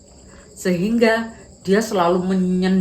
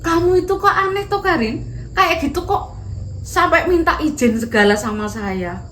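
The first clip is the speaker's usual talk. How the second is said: put-on higher, smiling girl's voice; smiling Dinda; 9 semitones higher, 3 dB louder than usual. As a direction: tease her kindly with a smile. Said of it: "sambil tersenyum-senyum dia bilang gini".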